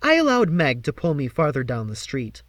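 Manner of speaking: ordinary delivery, nothing marked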